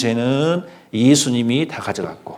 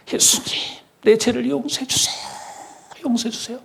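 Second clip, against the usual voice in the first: high, hushed imitation of a begging prayer; mock pleading prayer; 11 semitones higher, 5 dB quieter than usual. Preacher imitates a repentant believer at dawn, high pleading. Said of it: "막 회개했다가 합시다".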